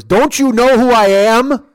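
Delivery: put-on loud, high, slow drunken bellow; indignant drunk. Bubba confronting the security guard, indignant bellow. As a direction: imitate a self-important drunk, stretching the words.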